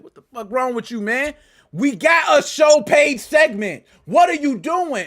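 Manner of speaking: loud angry rant at a friend who skipped the show; retold cussing out. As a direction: bark it like the boss chewing him out. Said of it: "He was cussing me out".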